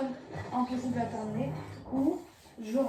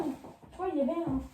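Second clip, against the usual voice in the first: ordinary delivery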